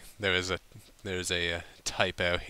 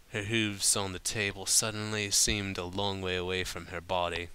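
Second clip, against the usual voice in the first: ordinary delivery, nothing marked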